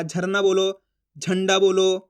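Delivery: ordinary talk, nothing marked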